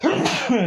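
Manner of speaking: a sudden loud sneeze; real sneeze bursting out. Real, not acted. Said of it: "I'm gonna sneeze here for a second".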